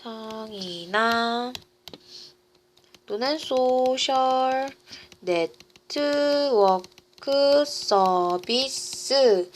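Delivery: very slow, syllable by syllable; long pauses between stretched words; slow dictation. Real, not acted. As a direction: draw each word out as you write it. Dictates while writing on board.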